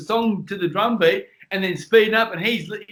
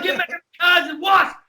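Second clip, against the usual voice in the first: frantic retold shout; 10 semitones higher than usual. Retold reply to the cop.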